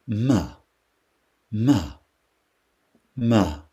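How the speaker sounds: ma, ma, ma said round and open; syllable ma. Shown as a demonstration. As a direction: repeat ma evenly, feeling it. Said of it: "Si je prononce ma".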